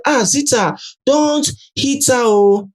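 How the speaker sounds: high-pitched cautioning call; retold alarmed warning. Fubara from one corner warning Zita not to hit, alarmed. Voices housemate calling from a corner.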